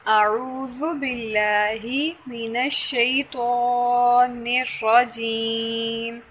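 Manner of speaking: slow, chanted Arabic recitation; measured Tajweed chant of the Ta'awwudh; Quranic recitation. Shown as a demonstration. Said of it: "Recite Tawuz".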